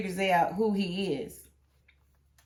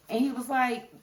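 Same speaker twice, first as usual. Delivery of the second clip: ordinary talk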